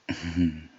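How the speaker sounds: short closed-mouth chuckle; amused chuckle. Acted. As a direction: chuckle briefly to yourself. Amused laugh during roleplay.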